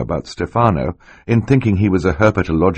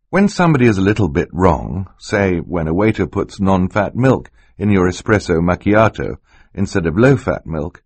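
ordinary delivery, nothing marked

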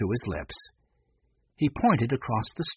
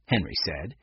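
plain reading voice

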